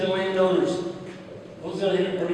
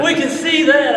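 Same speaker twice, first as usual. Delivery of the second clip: loud sarcastic neighbor; 7 semitones higher, 10 dB louder than usual. Retold or imitated. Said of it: "They're like".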